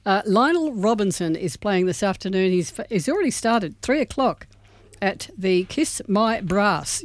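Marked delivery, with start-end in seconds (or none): 5.0-7.0 Kiss My Brass slowed right down; slow emphasis. Shown as a demonstration. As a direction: spell out Kiss My Brass slowly. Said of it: "you heard it correctly".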